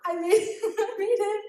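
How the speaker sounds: giggling through the words; laughing. Real, not acted. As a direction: laugh while you admit it. Giggling over her childhood bowl.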